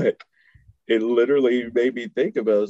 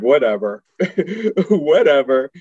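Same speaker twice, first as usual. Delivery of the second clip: put-on loud, laughing brush-off of his girlfriend's advice; dismissive; 5 semitones higher, 6 dB louder than usual. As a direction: wave her off, half laughing.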